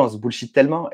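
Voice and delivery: ordinary talk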